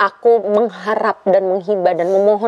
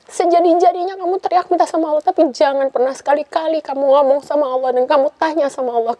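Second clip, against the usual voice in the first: urgent pleading; 8 semitones higher than usual. Retold or imitated. Mother's urgent, emotional instruction.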